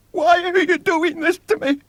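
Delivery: put-on very high, loud pleading boy's voice; high, loud desperate child's plea; frightened child. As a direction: cry out like a terrified little boy. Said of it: "said William".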